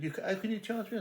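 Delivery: high-pitched disbelief; retold high, incredulous objection; indignant protest. Terry protests being charged.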